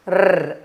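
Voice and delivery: "R" as a rolled "rrr"; letter sound of R. Shown as a demonstration. Teacher leading into the word rat, "R" trilled as "rrr". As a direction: roll "R" as a sound, not a name. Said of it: "the sound is R".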